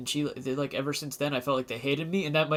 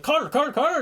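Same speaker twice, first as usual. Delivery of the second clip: calling out; 10 semitones higher than usual. Retold or imitated. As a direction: call him over the crowd. Security calling out name.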